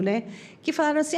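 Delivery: normal talking voice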